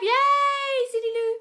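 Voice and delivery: very high, squealing hello; excited greeting. Real, not acted. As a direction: cheer as someone arrives. Greeting viewer joining.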